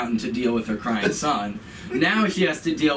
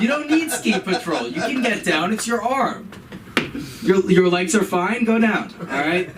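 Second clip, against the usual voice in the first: impatient mother mocking a hurt son, pitched up; sarcastic mom; 7 semitones higher than usual. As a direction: dismiss the kid's pain with sarcasm. Mom mocking son's injury.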